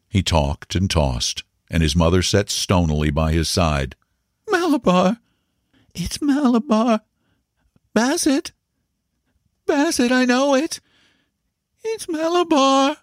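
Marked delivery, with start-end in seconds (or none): 4.5-13.0 put-on very high, delirious child's cries; crying-out child. A narrator's reading. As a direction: feverish boy calling out in bursts. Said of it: "So the child cried".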